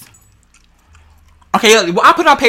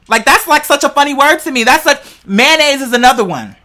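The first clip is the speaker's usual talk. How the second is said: normal talking voice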